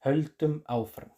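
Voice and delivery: höldum áfram said slowly in Icelandic; teacher models the phrase for learners. Shown as a demonstration. Slow Icelandic phrase. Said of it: "la palabra seguir en islandés".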